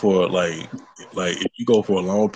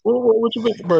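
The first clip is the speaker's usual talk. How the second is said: incredulous; 4 dB louder than usual. Real, not acted. Incredulous protest.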